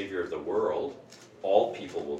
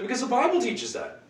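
mock matter-of-fact answer; 4 dB louder than usual. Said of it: "And so".

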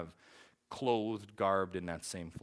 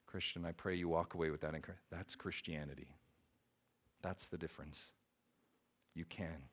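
ordinary delivery

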